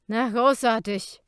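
put-on loud sneering drunk; barfly. A narrator's reading.

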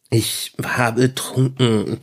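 halting, drawn-out reluctant confession; very slow, evasive stammer; hemming and hawing. A narrator's reading. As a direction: squirm your way slowly through the admission. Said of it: "druckste Falk herum".

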